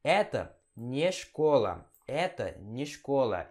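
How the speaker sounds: very slow "Это не школа", не as nie; careful Russian. Shown as a demonstration. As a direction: spell out "Это не школа" slowly. (pronúncia lenta e cuidadosa)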